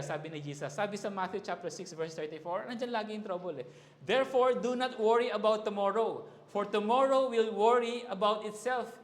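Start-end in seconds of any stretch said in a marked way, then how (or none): 4.0-8.9 louder proclaimed scripture, driving each phrase; forceful preaching. Acted. Preacher declaring scripture to the church, forceful and loud. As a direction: preach it out to the congregation.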